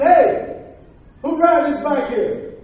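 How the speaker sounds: loud, slow challenge to the room. Retold or imitated. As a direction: shout the question, daring them.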